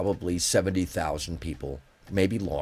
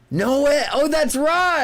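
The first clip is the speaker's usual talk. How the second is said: high, loud shout of sudden recognition; amazed recollection; 7 dB louder, slower than usual. Retold or imitated. Reminded of a forgotten tour moment, an amazed high shout. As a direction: cry out in astonishment as it comes back.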